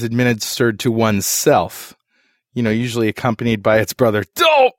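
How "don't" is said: high, loud self-reproach yelp; punchy high-pitched outburst; mock exclamation. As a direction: blurt it like a sudden forehead slap. Imitating the forehead-slap exclamation.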